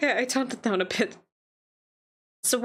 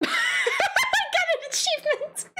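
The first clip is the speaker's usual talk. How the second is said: squealing high through laughter; real helpless laughter; slower than usual. Real, not acted. Player reacting to an achievement, real helpless laughter. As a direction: squeak it out slowly while laughing hard.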